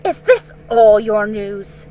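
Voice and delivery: loud, pressing queen; cold demand. Acted. Witch questions Edmund coldly.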